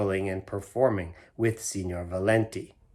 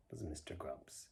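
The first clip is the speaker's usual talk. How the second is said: ordinary delivery, nothing marked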